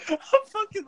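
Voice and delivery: high squeal of laughter through the words; laughing hard. Real, not acted. Golfer recalling the cart ride: laughing hard. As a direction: crack up mid-sentence.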